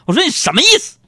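high, loud indignant outburst; comic indignant yell at his wife; mock angry retort. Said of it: "我就生气了".

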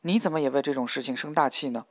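plain reading voice

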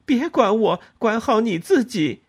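高声冲婆婆吼回去; 一点不让地顶撞; 愤怒喊叫. A narrator's reading. 和婆婆激烈争吵. (高声怒吼顶撞婆婆)

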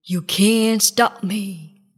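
slow, big boastful lion. A narrator's reading. Lion defies the jungle animals, slow boasting. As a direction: defy them as a bullying lion. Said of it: "said the lion".

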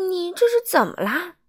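storybook surprised doctor; exclaiming. Acted. Doctor crying out at the small man's bruises. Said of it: "大叫起来".

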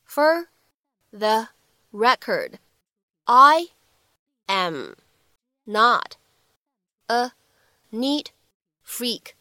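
each word said alone with long pauses; spaced-out dictation delivery; slow word-by-word reading. Shown as a demonstration. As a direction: read it one word at a time, slowly. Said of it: "我们需要注意以下的发音技巧".

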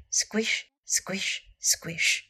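soft whispered squishes; squishing sound. A narrator's reading. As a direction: imitate wet leaves squelching underfoot, hushed. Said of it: "Dragon's feet went squish".

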